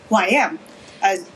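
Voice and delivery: ordinary delivery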